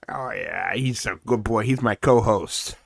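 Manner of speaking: ordinary talk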